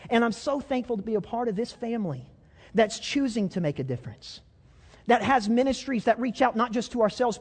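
high, loud, ringing preacher's call; rally the church to keep going; full-force preaching. Acted.

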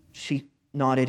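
usual speaking voice

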